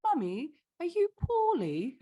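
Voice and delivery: imitating a little son's English accent, pitched high. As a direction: mimic a child's very English lilt, high and rising. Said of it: "like, this really English accent".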